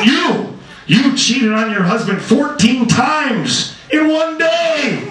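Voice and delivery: high, slow, drawn-out denunciation; acted accusing shout. Saint Peter calling out a sinner at the gates, accusing shout. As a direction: shout the charge, stretching the final words.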